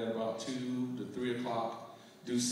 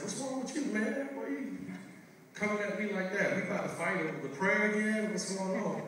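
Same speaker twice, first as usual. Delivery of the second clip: high-pitched, startled, defensive retort; incredulous; 9 semitones higher than usual. Retold or imitated. Answering the crying tour manager, high and startled. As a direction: pitch it way up, thrown off guard. Retelling his alarmed reply to manager.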